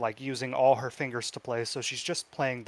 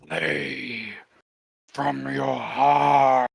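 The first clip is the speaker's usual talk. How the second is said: slow demonic rasp, swelling on the last word; deep raspy voice; 6 dB louder, much slower than usual. Acted. Demonic brother urging the pianist on, deep slow rasp. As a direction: rasp it out low, drawing out the final word. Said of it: "a soft, deep, raspy voice say".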